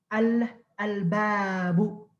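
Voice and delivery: slow al-babu with the l held before b; sounded lam. Shown as a demonstration. Teacher modelling a moon-letter example, sounded lam. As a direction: draw out al, then al-babu. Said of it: "You cannot say ab-babu".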